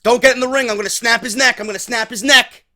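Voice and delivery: hammed-up menacing shout; mock threat. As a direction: yell a hostage threat at the approaching giant. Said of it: "Big Cass was like".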